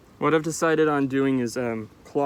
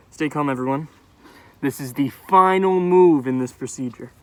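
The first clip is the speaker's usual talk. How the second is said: loud announcement; 10 dB louder than usual. Pretend. Mock surgeon addressing crowd.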